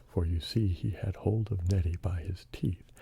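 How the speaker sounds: ordinary delivery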